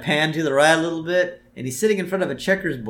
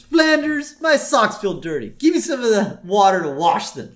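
Homer demanding; 7 semitones higher, 4 dB louder than usual. Retold or imitated. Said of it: "And Homer says".

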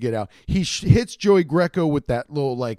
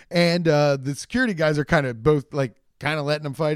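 ordinary delivery

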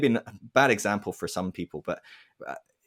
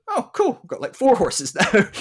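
high, louder, thrilled with a laugh; delighted farmer; 11 semitones higher, 5 dB louder than usual. Acted. Farmer telling friends about four horses, thrilled and laughing. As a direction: gush over the free horses.

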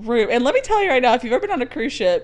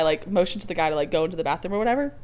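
ordinary talk

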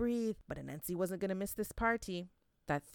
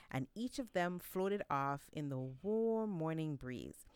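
usual speaking voice

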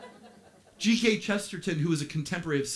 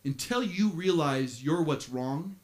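ordinary talk

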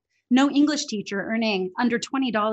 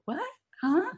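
mock baffled, the Huh pitched up high; retold disbelief; 8 semitones higher, slower than usual. Recalling her reaction to a client, mock surprised. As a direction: replay the shock of hearing grammar doesn't matter. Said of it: "Before I was like".

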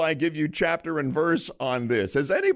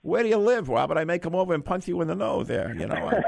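Mike Tyson voice; 3 semitones higher than usual. Acted. Host as the boxer greeting the winning caller, little teeny voice. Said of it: "finally recognized my voice".

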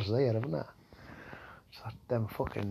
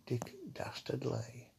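hushed, breathy whisper of the name; 13 dB quieter, slower than usual. Shown as a demonstration. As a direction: whisper it slyly like a secret. Name repeated as sly whisper.

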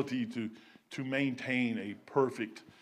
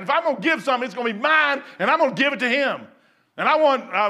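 loud, high fiery preaching; preaching at full force; 8 semitones higher, 8 dB louder than usual. Acted. Preacher insisting wise men carried gifts, loud preaching. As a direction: drive it home to the congregation.

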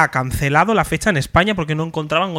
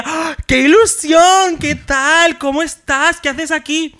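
higher, slow gushing greeting; imitating idol's delight; 9 semitones higher, 3 dB louder, much slower than usual. Imitating Trixie gushing over meeting the fan, high-pitched. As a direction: gush like a thrilled star.

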